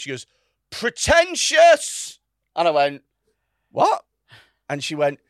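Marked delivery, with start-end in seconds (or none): 0.7-2.2 high, drawn-out mock scream; retold scream. Woman piping up at the art talk, mock screaming. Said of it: "she screams". 3.7-4.0 high, loud burst of disbelief; startled retort. Retold or imitated. Comedian answering the screaming woman, acting startled.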